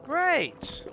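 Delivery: high sarcastic cry. Real, not acted. Game glitched.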